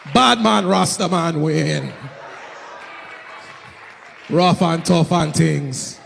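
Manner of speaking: slow, sinking mock tough-guy patois; falling Jamaican persona. Comic signs off as his tough patois persona, sinking low. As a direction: let the persona name sink low and slow.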